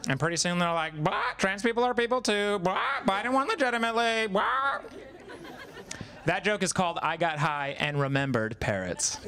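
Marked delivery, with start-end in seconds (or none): 1.0-4.8 squawking parrot. Retold or imitated.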